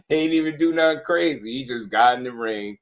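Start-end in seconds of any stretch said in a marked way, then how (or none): 0.1-1.5 higher and fast, tickled; amused disbelief. Real, not acted. Host mocks angry fans, excited amused. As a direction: rush it out, cracking up inside.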